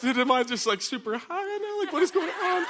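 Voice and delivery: very high-pitched, disbelieving squeal; acting out a confused, panicked teen; bewildered. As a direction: climb into a squeaky, freaked-out voice. Bewildered high teen questions reality.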